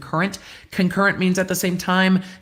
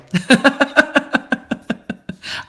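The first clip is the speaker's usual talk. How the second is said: laugh; 8 semitones higher than usual. Real, not acted. Laughs after her singing.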